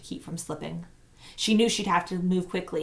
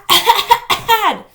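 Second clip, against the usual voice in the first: dry cough breaking the call; 13 dB louder than usual. A narrator's reading. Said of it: "her throat was dry and weak".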